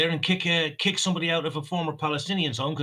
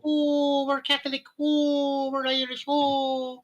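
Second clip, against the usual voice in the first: high put-on voice mocking plastic Paddies; hammed-up high mock voice; mock plastic Irish; 10 semitones higher, much slower than usual. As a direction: squeal it like a caricature.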